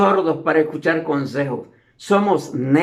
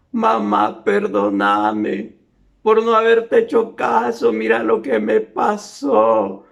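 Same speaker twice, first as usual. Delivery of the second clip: llorando; 3 semitones higher than usual. Retold or imitated. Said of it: "aparece llorando".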